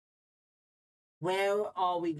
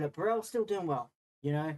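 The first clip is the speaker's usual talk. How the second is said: ordinary delivery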